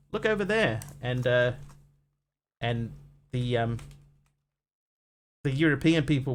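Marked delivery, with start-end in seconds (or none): 0.1-0.8 higher, calling out while pointing; mock native caller pointing at the animal. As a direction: call it out as if pointing across the bay. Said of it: "they were going".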